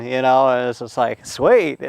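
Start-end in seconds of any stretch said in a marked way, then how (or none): 1.3-1.8 louder, higher happy exclamation; retold delight. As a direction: replay a kid's glee at good news. Boy delighted to skip school.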